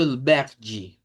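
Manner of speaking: "verde" ending in an I, "verdji"; Portuguese verde. Shown as a demonstration. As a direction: model "verde" the Brazilian way. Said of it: "cambiamos el sonido de la E al final por I".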